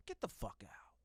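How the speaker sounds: hushed mock-angry whisper; breathy mock dismissal. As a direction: whisper it like a playful threat. Co-host mock-scolding the host.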